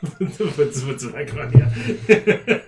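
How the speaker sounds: breaking into high, loud giggles by the end; laughing. Real, not acted. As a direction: narrate through real laughter. Game master cracks up while narrating.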